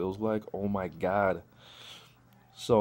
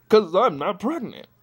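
louder incredulous; 11 semitones higher, 8 dB louder than usual. Retold or imitated. Incredulous outburst.

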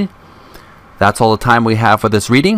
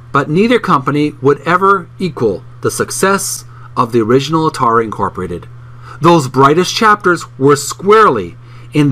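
higher, lively read-aloud voice from the book; animated book reading; 4 semitones higher than usual. Acted. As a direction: read the passage like an engaged narrator. Reads book passage aloud.

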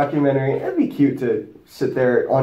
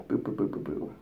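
mumbled filler; 8 semitones lower, 14 dB quieter than usual. Real, not acted. Mumbling while reading.

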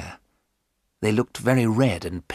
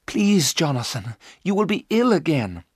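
worried plea; 6 semitones higher than usual. A narrator's reading. Mina pleads with agitated husband.

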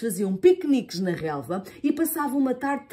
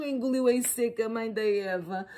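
ordinary delivery

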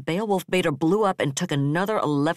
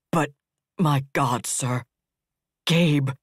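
raw anguish; 3 dB louder, slower than usual. Acted. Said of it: "voice was raw with anguish".

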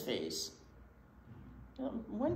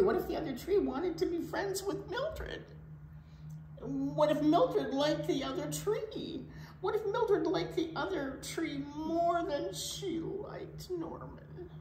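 higher, anxious, voice near tears; worried whine; 10 semitones higher than usual. Acted. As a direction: fret more and more, ending in a whisper. Norman worrying aloud.

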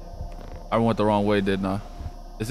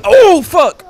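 startled scream; 11 dB louder than usual. Real, not acted. Player opens door to a scare, startled scream. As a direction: gasp into a loud high shriek.

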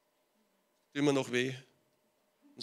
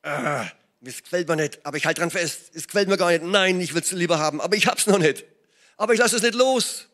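stubborn resolve; 3 semitones higher, 7 dB louder than usual. Retold or imitated. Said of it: "Ausharren heißt".

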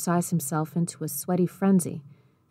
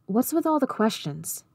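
higher-pitched, irritated question; 6 semitones higher than usual. A narrator's reading. Boy challenging two men's questions, irritated. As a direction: push back with mild irritation, pitch lifted. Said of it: "Cole found himself irritated".

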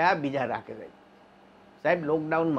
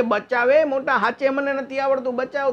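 high, pleading call for help; a drowning man begging his friend to save him; desperate plea; 8 semitones higher than usual. Retold or imitated. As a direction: beg to be saved, pitched up.

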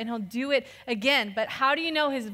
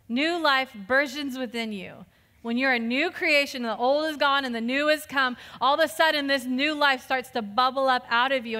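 ordinary delivery

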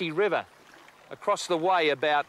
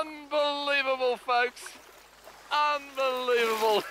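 amazed; 6 semitones higher, slower than usual. Real, not acted. Amazed at the catch.

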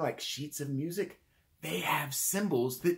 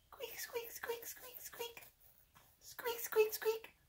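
tiny, very high, soft squeaks; mouse squeak; 12 dB quieter, slower than usual. Shown as a demonstration.

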